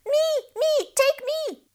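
high, excited fish voices; eager calling. Acted. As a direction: squeal to be picked. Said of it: "called the fish".

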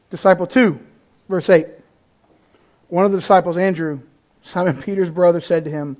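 ordinary talk

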